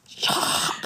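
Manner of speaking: hushed whispered shush. Retold or imitated. Mum whispering to the kids to hush. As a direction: hiss it quietly to silence the kids. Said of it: "Like this".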